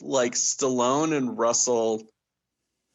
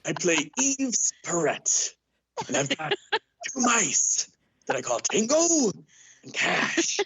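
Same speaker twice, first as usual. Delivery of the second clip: Jack Palance impression; 8 semitones higher than usual. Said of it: "so Jack Palance filled in for them".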